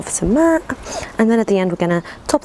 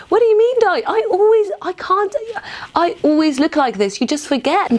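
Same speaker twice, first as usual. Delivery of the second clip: exasperated self; 11 semitones higher than usual. Retold or imitated. [name] answering her aunt, high exasperated protest. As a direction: protest like an indignant niece. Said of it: "I'm like".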